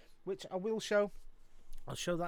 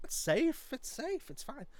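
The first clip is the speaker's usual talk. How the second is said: mock reassuring self-talk; 11 semitones higher, 4 dB louder, slower than usual. Retold nervous self-talk on shelf.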